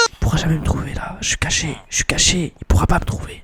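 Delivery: very low, barely voiced whisper; hushed whisper. Player hiding from the golem, hushed whisper.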